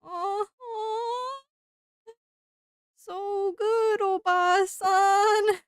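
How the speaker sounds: high, drawn-out strained politeness; fake polite enthusiasm. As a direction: force a pained sweet agreement. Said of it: "we're like".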